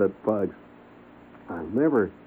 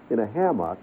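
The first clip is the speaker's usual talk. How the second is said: ordinary talk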